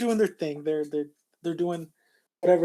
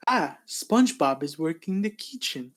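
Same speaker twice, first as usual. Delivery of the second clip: ordinary delivery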